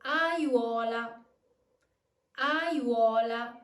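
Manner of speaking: "Aiuola" as one firm word, a-ywo-la; teacher demo of "Aiuola", triphthong in one glide; whole word joined. Shown as a demonstration. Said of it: "So let's put these together".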